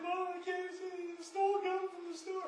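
put-on high, strained child blurting it out; retold tearful confession. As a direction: burst out the confession like a guilty kid. Boy confessing to mom, distraught.

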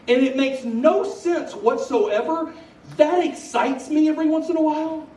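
high, loud, emphatic preacher delivery; heartfelt testimony at full force, key phrases stretched; impassioned preaching. Real, not acted. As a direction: preach it with rising fervour.

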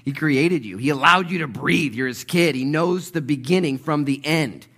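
high, loud, driving sermon delivery; full-force preaching. Real, not acted.

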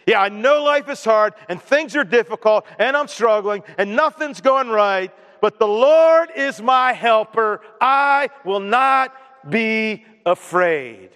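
troubles listed, then a slow, drawn-out proclamation; confident declaration. Acted. Believer answers life's struggles, slow confident declaration. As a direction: build to the verse and stretch it boldly. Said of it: "until you say it with confidence".

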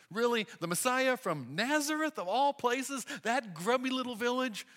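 high, loud scornful doubt; scoffing disbelief. Retold or imitated. Nathanael scoffs at Nazareth.

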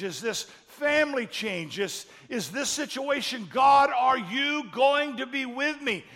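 high, loud, slow pleading cry to God; desperate plea. Acted. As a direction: beg God for an answer, voice straining high.

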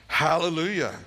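Hallelujah cried out high and loud; exultant cry. Acted. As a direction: shout it like a great multitude. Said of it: "crying out".

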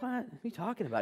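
high-pitched retold indignation; mildly irked, incredulous comeback; ticked off. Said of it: "kind of ticked off".

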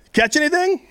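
light, high-pitched casual question; casual high call. Acted. Jesus calls from the shore to the fishermen, high and casual. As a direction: call it out like a friendly stranger. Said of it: "and he says".

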